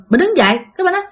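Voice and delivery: usual speaking voice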